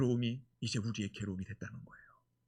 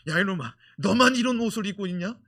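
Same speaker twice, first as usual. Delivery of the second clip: brother yelling; 6 semitones higher, 12 dB louder than usual. Retold or imitated. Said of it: "또 형이 뭐라고 말하겠어요".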